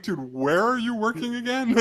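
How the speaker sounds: can't believe what you just heard; incredulous. Retold or imitated. Mocking Cooper's raise with a high incredulous question.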